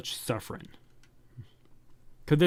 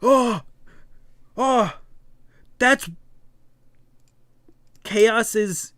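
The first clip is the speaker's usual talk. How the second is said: much higher, louder startled cries; shocked gasp; 9 semitones higher, 5 dB louder, much slower than usual. Acted. Companion is stunned by the reveal, high loud startled cries. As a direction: react in sudden shock.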